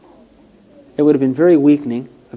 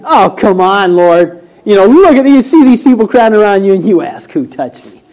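loud, mocking Peter scoffing at the Lord; sarcastic Peter; 4 semitones higher, 6 dB louder than usual. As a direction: scoff at him, louder and higher, mock disbelief. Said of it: "It was very sarcastic kind of".

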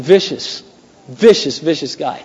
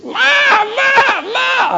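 high bleating lamb; much slower than usual. Retold or imitated. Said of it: "I can't do my imitation".

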